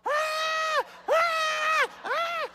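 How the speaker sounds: high shrieking "ah" screams; mock screaming. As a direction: shriek like a panicked wife. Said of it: "she's going".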